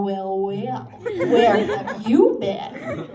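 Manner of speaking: hammed-up slow stern father catching a late child; mock-menacing drawn-out question. Father in the dark chair confronting his late child, mock stern. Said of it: "the father who's ... sits in that fancy chair".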